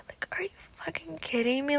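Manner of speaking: incredulous whisper; whispered outrage. Real, not acted. Viewer fuming about a character's mistake, whispered outrage. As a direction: hiss it in disbelief.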